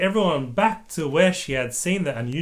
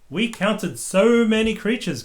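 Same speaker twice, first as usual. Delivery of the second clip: excited; 6 semitones higher than usual. A narrator's reading. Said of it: "cried Peppa excitedly".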